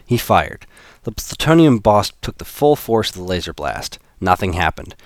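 plain reading voice

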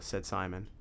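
ordinary delivery

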